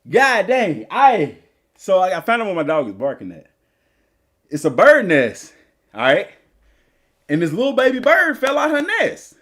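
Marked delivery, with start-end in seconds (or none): none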